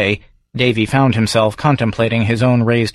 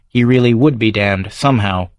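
ordinary delivery, nothing marked

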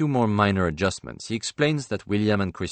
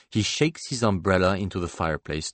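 plain reading voice

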